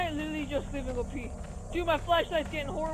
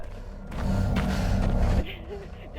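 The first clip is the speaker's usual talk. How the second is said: breathy nervous burst of laughter; 6 semitones higher, 6 dB louder than usual. Real, not acted. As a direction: laugh off the fright. Laughs after scare.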